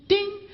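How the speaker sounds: short, high ding; ding of a bell. Pretend. Comedian tallying points for acts of kindness, quick bell ding. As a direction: imitate a scoreboard bell.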